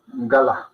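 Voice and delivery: "Galá" with both vowels sounded; two clear syllables in "Galá", stress last; different word. Shown as a demonstration. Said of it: "una palabra totalmente diferente".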